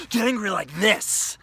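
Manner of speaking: high and loud, letting rage out; loud open anger; full anger. Shown as a demonstration. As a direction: show how to really get angry. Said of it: "Don't get angry like this".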